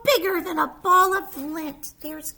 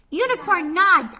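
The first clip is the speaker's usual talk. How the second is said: plain reading voice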